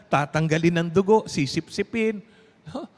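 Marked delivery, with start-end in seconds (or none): none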